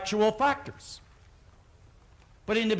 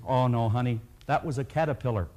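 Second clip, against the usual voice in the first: much lower parent voice; reassuring parent; 9 semitones lower, faster than usual. Retold or imitated. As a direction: imitate a parent gently correcting a child. Parent reassuring child in story.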